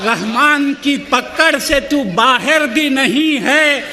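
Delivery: measured, rhythmic mushaira delivery; stately nazm recitation. Acted. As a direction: recite each couplet with gravity.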